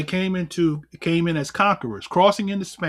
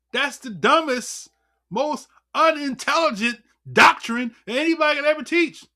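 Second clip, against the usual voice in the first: usual speaking voice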